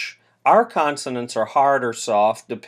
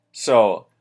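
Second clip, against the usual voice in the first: ordinary delivery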